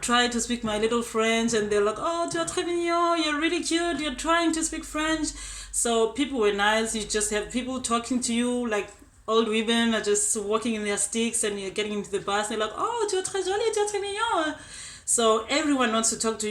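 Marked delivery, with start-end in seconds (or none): 2.0-5.3 lightly raised, sweet imitation of a charmed French local; cooing French local. She imitates locals praising her French, lightly raised and cooing. 12.8-14.6 high, cooing imitation of an elderly woman; sweet old French lady. She imitates old women on the bus cooing compliments, high and sweet.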